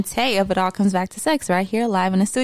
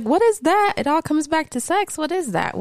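confused; 8 semitones higher than usual. Retold or imitated. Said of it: "I know you guys are like".

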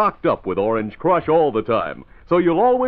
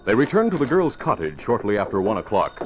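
lower, steady story narration; radio drama narrator; 3 semitones lower than usual. Acted. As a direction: set the scene calmly.

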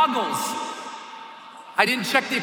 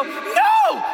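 high-pitched panicked cry; acted last-second terror; terrified refusal. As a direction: wail it as you're shoved out. Refusing as he gets thrown out.

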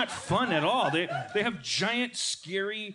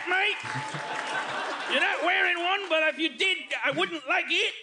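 put-on Australian accent; 8 semitones higher than usual. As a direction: shout it like an angry Aussie bloke. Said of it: "I can't do an Australian accent".

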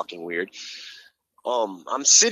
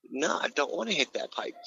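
soft refusal; 2 semitones higher, 9 dB quieter than usual. Retold or imitated. Refusing the voices.

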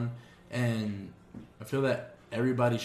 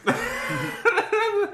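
slow words squeezed out between laughs; giggling, teasing knowingly; laughing; 5 semitones higher, 4 dB louder, slower than usual. Real, not acted.

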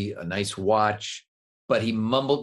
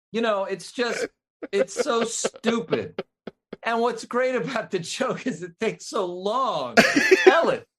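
laughing while talking; 10 semitones higher, 3 dB louder than usual. Real, not acted. Laughs at own joke.

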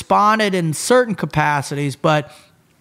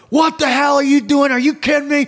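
retold screaming; 10 semitones higher than usual. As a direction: scream at the trainee like a range instructor. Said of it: "I'm screaming at her".

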